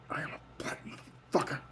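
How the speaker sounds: whispered joke about herself. Real, not acted. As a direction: murmur it to yourself. Whispered self-label.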